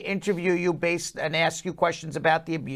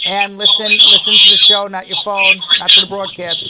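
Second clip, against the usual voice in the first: raised voice, firm and loud; 2 semitones higher, 11 dB louder than usual. Real, not acted. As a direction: call it out loud over the line. Host scolds caller about broadcast delay.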